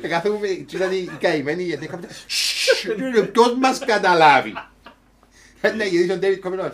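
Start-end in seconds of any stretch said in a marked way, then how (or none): 2.3-2.9 shushing hiss. Retold or imitated. Shushing him in the audience. 3.3-4.5 loud scolding. Retold or imitated. Scolding friend in the audience.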